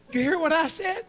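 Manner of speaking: high loud demand; insistent. Retold or imitated. Doctor insists the patient heard him, high and loud. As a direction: press the patient hard.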